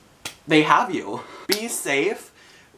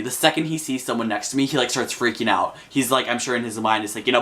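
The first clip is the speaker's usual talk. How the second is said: usual speaking voice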